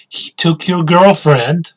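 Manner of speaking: usual speaking voice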